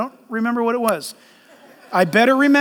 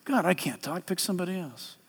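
a quiet, lower plea to God; softer pleading Moses; 6 semitones lower, 13 dB quieter than usual. Pretend. Preacher voicing a reluctant Moses, soft and lower. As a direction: shrink away from the call. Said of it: "We're just like Moses".

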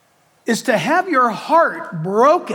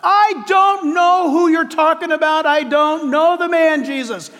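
panicked denial; 8 semitones higher than usual. Acted. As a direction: deny it in a rush of fear. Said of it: "Peter began to curse and swear".

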